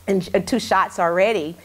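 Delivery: usual speaking voice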